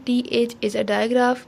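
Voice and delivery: normal talking voice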